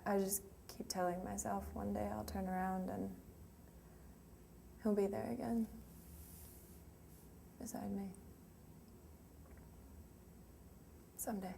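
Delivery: soft, halting hope with long pauses; wistful grief. Acted. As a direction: trail into a very quiet longing. Grieving, hoping he will return.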